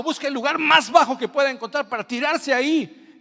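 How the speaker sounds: high, loud preaching; impassioned preaching. Acted. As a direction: press the point with fervour.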